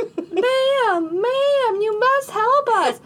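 high, drawn-out hillbilly plea; hammed-up hillbilly accent, slow and high, begging; put-on hillbilly accent. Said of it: "why am I hillbilly".

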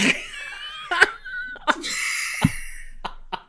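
long high squealing laugh; helpless laughter. Real, not acted. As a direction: laugh until you can't stop.

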